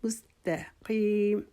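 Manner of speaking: مستقیم with میم سین تا light, slow; thin letters. Shown as a demonstration. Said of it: "باریک پڑھنے ہیں".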